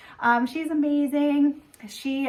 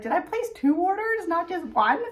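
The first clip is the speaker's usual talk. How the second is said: mock panic; 6 semitones higher than usual. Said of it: "this panic".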